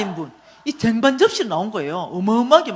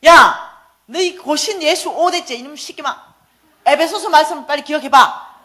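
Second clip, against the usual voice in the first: loud, higher, exasperated shout; angry yell; 5 semitones higher, 8 dB louder than usual. Retold or imitated. Mother yelling at her son to recall Ephesians, loud angry shout. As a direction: bark at him to remember the verse.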